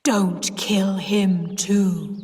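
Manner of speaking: slow ghostly whisper; slow voiceless warning from a ghost; deep whispered voice. A narrator's reading. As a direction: breathe it slowly like a hidden ghost. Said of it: "she said in a deep voice".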